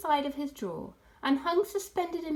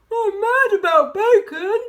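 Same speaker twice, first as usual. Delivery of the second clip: much louder, higher gleeful badger voice; excited cry; 8 semitones higher, 13 dB louder than usual. A narrator's reading. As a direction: burst out with greedy joy. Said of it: "cried Badger".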